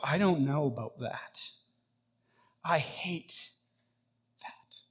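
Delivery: low, hushed, halting confession; inward admission. Acted. Believer privately in the heart objects to God, quiet and halting. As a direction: admit it under your breath, with pauses. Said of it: "And in your heart, you go".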